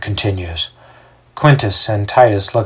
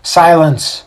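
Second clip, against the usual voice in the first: higher-pitched call to order; mildly raised command to a crowd; 7 semitones higher, 4 dB louder than usual. A narrator's reading. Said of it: "raises his hand ... and commands".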